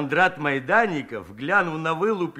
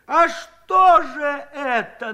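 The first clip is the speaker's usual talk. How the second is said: much higher, slow, louder demand; pressing angrily; 9 semitones higher, 6 dB louder, much slower than usual. Acted. Furious Aniska presses the old man, slow angry demand. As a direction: press him hard. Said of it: "наседала Аниська".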